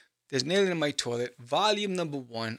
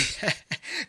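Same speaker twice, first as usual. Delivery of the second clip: short laugh; 11 semitones higher, 4 dB quieter than usual. Real, not acted. Laughs at own joke.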